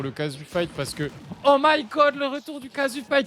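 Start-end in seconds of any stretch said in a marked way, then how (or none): 1.4-3.3 high, loud mock-excited fan; gushing retold enthusiasm, pitched way up; imitated hype. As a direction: act out someone's overexcited reaction. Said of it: "il était trop hype et tout et tout".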